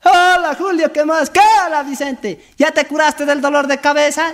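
hammed-up high paisano; high comic voice. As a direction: squeak it out like a cartoon peasant. Characters trade greetings.

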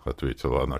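ordinary delivery, nothing marked

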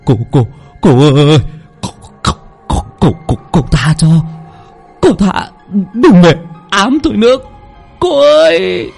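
put-on stuttering frightened young man; terrified begging. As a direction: beg the ghost for mercy in panic. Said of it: "miệng nó cứ lúng ba lúng búng".

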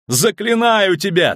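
very high, drawn-out cry; impassioned command; desperate conjuring. A narrator's reading. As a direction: cry the spell out at full pitch. Conjuration at its climax.

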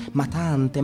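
normal talking voice

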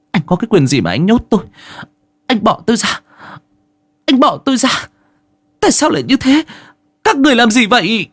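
high-pitched panicked protest; tearful screaming. A narrator's reading. Captive wife pushing away the servant locking her up, tearful panicked cries. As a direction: cry out in rising desperation. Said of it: "hét lên trong nước mắt nhạt nhòa".

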